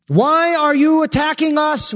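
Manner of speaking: high, loud demon's protest; panicked demon cry. Acted. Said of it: "would be an accurate translation".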